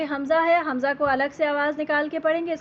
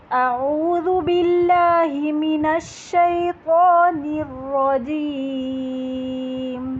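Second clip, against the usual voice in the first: slow Quranic recitation; much slower than usual. Shown as a demonstration. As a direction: recite it slowly like in prayer. Tajweed recitation opening. (آہستہ قرآنی تلاوت)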